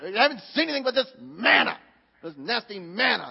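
acted exasperation, spitting out the manna; aggravated. Preacher voicing Israelites sick of manna, aggravated. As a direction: grumble in mock disgust. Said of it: "they're, they're aggravated".

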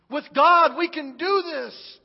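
GOD punched loud, then slow and firm; rally them with conviction; Caleb's rallying cry. Acted. Caleb urges the frightened people, loud on God.

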